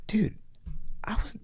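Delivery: whispered Dude, I was; hushed confession. Retold or imitated. Agent confessing to Eric that he's on parole, whispered. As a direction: drop to a whisper to share a secret.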